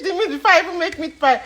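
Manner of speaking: fast, laughing through it. Real, not acted. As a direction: giggle while saying it. Laughing after tasting.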